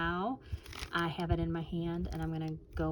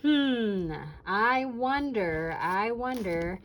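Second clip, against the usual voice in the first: curious musing; 3 semitones higher, 6 dB louder, much slower than usual. Retold or imitated. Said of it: "I looked at it and I seen the monkey and I went".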